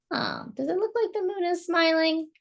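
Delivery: usual speaking voice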